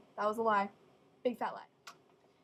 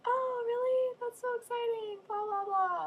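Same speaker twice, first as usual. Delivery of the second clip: much higher, gushing mock excitement; gushing friend; 12 semitones higher than usual. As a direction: play the giddy friend faking delight. Friend Katie's excited reply.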